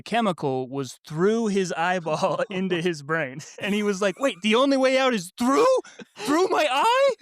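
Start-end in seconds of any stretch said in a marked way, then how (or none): 4.2-7.1 high, rising disbelief, mock-horrified; imitating an alarmed child, pitch shooting up; retold panic. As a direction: squeal it like a kid taking it literally.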